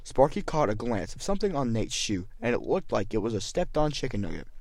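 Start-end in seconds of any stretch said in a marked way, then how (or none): none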